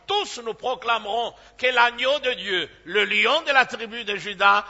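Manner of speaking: preacher lifting his congregation in praise; fervent proclamation. Acted. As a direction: build the praise toward heaven.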